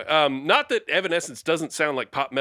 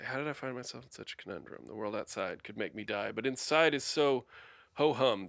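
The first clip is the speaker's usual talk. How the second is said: quiet recital; 2 semitones lower, 8 dB quieter than usual. Shown as a demonstration. Reciting lyric softly.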